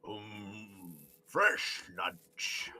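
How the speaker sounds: low, slow creature voice; drawn-out, hungry monster voice; hungry frog monster. Acted. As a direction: savour it like a hungry giant frog. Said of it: "you hear from within and behind the".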